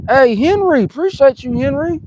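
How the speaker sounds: high, loud greeting; shout-out. Real, not acted. Thanking a viewer who just joined, excited shout-out.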